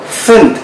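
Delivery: Fent said with a clear f sound. Shown as a demonstration. Teacher isolates Fent to show ph as f. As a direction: stress the f in Fent. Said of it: "huruf P H dibaca seperti huruf F".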